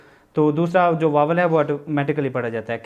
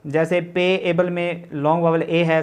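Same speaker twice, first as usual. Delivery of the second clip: ordinary talk, nothing marked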